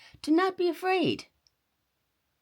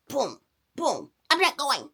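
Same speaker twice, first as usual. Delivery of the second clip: put-on stamping stubborn boy; 6 semitones higher, 3 dB louder than usual.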